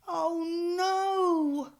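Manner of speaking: high, loud cry of dismay. A narrator's reading. George finding the shop closed, crying out. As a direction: wail it as the shop is shut. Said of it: "cried George".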